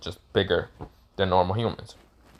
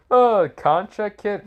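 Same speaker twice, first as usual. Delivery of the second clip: mocking laugh; 11 semitones higher, 5 dB louder, slower than usual. A narrator's reading. Gods mock Naruto's contract question.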